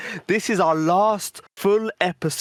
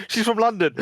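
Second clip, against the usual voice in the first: ordinary delivery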